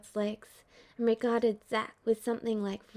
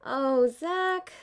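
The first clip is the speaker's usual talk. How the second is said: exasperated sigh; 4 semitones higher, 5 dB louder than usual. Acted. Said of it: "Aerith said with a sigh".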